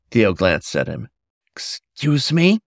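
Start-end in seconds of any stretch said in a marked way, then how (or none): 1.5-2.6 slow, higher, puzzled question. Acted. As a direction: drag it out, baffled. Theo puzzled by odd word.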